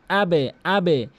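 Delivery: আবে with a bounced e-coloured ব; downward qalqala. Shown as a demonstration. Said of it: "নিচের দিকে সাউন্ডটি করতে হবে". (আবে, নিচের দিকে ধাক্কা)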